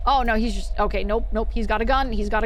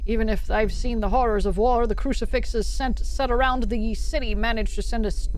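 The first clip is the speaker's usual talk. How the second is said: narration; 4 semitones lower than usual. Acted. Reads in-game journal text.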